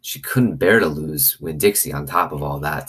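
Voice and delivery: ordinary delivery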